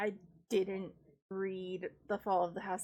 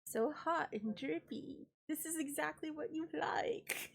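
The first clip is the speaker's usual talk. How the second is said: mock seductive; 5 semitones higher than usual. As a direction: purr it at your husband, joking. Teasing husband, slime joke.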